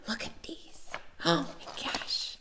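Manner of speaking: gasp, then a slow, awed whisper. Real, not acted. Teacher gasping over her preschoolers' crowns, slow awed whisper. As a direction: breathe it out, overwhelmed by cuteness. Showing class's finished crowns.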